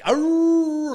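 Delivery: high, loud imitated dog howl; mock dog howling along to the squeeze box. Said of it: "like this".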